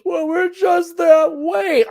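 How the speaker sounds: high, slow put-on voice, mock-innocent; mocking girls' excuse. Mimicking excuses heard from girls, high slow mocking voice. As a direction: parrot the excuse in a silly high voice. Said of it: "a lot of excuses I get from girls".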